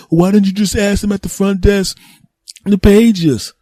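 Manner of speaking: high-pitched nagging question; reproachful relative. Acted. Relative asking why they weren't paged, high and reproachful. Acts out relative scolding the searcher.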